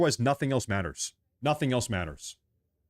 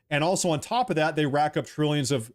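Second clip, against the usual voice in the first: usual speaking voice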